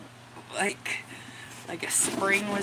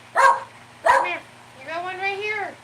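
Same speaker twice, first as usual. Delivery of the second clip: high loud come here; high call to dog; 11 semitones higher, 8 dB louder than usual. Real, not acted. Owner calling her dog for a treat, high and loud. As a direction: call the pup over brightly.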